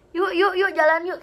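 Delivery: imitating an anxious mother; mom hurrying away. Mother hurrying her child out of the haunted toilet, loud and urgent.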